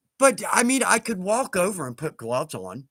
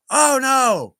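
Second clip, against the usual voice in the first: loud, high cry of dismay; dismayed exclamation; 7 semitones higher, 8 dB louder than usual. Real, not acted. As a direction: burst out in dismay at bad news. Reacts to a viewer's mishap.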